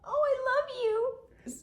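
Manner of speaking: high slow adoring pet talk; gushing at the cat. Real, not acted. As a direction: melt over the cat, voice soaring.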